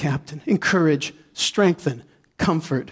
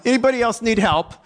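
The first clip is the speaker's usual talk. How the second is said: cocky offer; 6 semitones higher, faster than usual. Retold or imitated. Triumphant joke.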